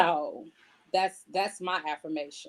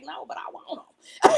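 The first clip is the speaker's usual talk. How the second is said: whispered aside; whispered quote; 9 semitones higher, 4 dB louder than usual. Retold or imitated. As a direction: breathe it out like a confession. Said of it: "And he like".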